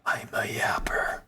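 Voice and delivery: unvoiced whisper, slowed down; a playful whispered admission; whispered confession. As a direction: let it out as a secret. Confessing own chattiness.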